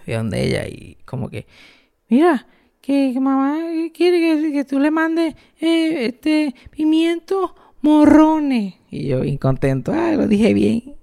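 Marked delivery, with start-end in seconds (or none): 2.1-8.8 put-on high, slow child voice carefully delivering the message; a small boy reciting his errand; nervous little kid. Little boy telling grandma what mom wants, high and careful. 9.9-10.9 put-on little kid voice. Child proud of himself.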